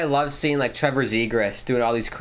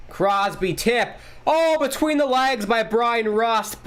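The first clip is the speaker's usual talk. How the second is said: excited call; 10 semitones higher, slower than usual. Real, not acted. Sudden scoring chance call.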